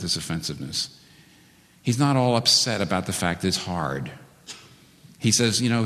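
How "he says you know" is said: ordinary talk, nothing marked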